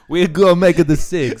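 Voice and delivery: high hammed-up Borat impression. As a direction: echo the Borat line with gusto. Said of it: "yeah, that was Borat".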